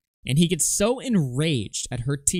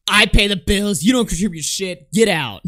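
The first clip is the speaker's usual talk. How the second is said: mocking yell; 4 semitones higher, 6 dB louder than usual. Mock re-enacting the streamer.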